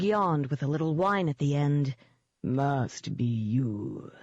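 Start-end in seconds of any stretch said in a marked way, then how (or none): 2.4-4.1 low, slow, sugared beast voice; honey-sweet demon dog. A narrator's reading. Demon dog sizing up its prey, honey-sweet.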